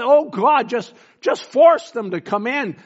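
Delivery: loud, high cry of a worn-out parent; exasperated cry. Retold or imitated. Parent of a troubled child cries out to God, exasperated. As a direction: plead loudly to God, exasperated. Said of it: "in exasperation cried out".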